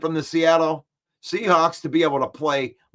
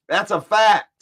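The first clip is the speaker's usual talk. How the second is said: louder, with a rising "fact"; emphatic insistence; 4 semitones higher, 5 dB louder than usual. Real, not acted. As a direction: slam it home with a rise on "fact".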